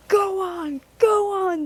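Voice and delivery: high, loud yell. A narrator's reading. The trucks yell as they bump Percy, high and loud. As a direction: shove forward with a shout. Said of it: "they yelled".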